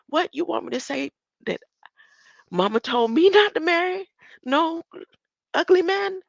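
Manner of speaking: high-pitched, rising to a shout; incredulous outburst. Acted. Acting out the singer balking at the ugly man lyric, incredulous. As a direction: squawk it in mock disbelief.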